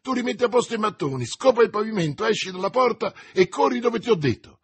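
high, fast shouted commands; hurried shouting at the servant; shouted orders. A narrator's reading. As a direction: bark quick orders while leaving. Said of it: "gridò D'Artagnan".

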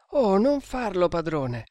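urgent, pleading cry; alarmed cry. A narrator's reading. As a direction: cry out in protest, pitch leaping up then dropping. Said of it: "gridarono a un tempo i due indiani".

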